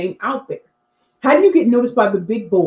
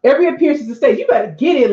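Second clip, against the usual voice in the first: usual speaking voice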